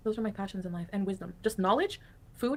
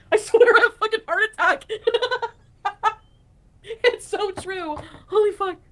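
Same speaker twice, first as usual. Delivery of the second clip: laughing; 10 semitones higher, 8 dB louder than usual. Real, not acted. Laughing at heart attack story.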